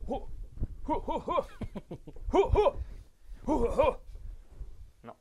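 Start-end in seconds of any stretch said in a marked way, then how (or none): 0.1-4.0 high loud hooting calls; animal hooting. Pretend. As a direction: hoot wildly like an animal. Acting a pig or creature.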